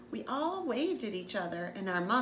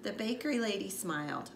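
ordinary delivery, nothing marked

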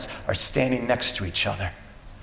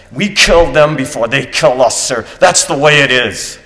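loud, raised-pitch soldier's outburst; defiant shout; 5 semitones higher, 11 dB louder than usual. Acted. Soldier justifying the killing to his captain, defiant shout. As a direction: shout it at your commander, insisting.

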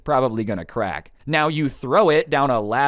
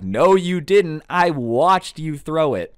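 higher, louder and slow, every word laid down hard; angry contradiction; 5 semitones higher, 5 dB louder, slower than usual. Retold or imitated. Cousin calling out the kid who threw the iPad, angry. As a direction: call out the liar, slowly and firmly.

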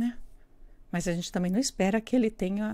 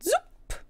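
zip sound effect. Acted. Bolinho foge.

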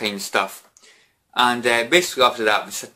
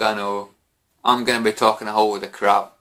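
ordinary delivery

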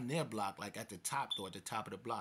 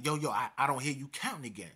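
loud, raised-pitch warning; calling out; 4 semitones higher, 9 dB louder than usual. Retold or imitated. Storyteller calls to captive who slowed his count, shouting. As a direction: yell it back over a fence.

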